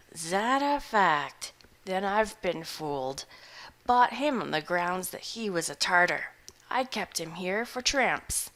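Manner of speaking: a hungover hermit shrugging it off; gruff old farmer. Acted. Butterbloom continues.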